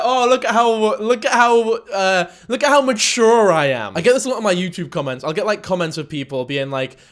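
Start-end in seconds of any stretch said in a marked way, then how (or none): 0.0-4.0 higher, louder, sneering mimicry; mock their smug self-praise. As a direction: parody of a preening commenter. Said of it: "I fucking hate that virtue signal".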